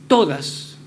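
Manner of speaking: emphatic "todas" standing alone; stressed todas. Shown as a demonstration. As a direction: land hard on "todas" as the key word. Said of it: "es enfatizar la palabra siguiente".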